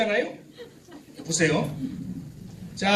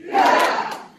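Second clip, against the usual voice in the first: loud shout; 4 dB louder than usual. Shown as a demonstration. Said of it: "천정을 뚫고 올라가야 돼".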